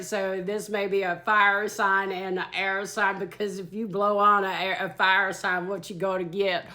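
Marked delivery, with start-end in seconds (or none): none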